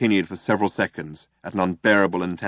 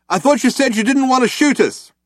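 loud and high, half ironic; calling out; 6 dB louder than usual. A narrator's reading. Ford calling to the cops, raised and high. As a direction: fling it across the room. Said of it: "and ducked again".

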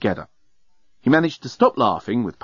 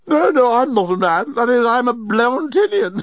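high, tipsy protest breaking into giggles; giggly; 12 semitones higher, 3 dB louder than usual. A narrator's reading. Intoxicated journalist corrects Nettie, giggling. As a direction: lose control toward helpless laughter. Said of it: "had started giggling".

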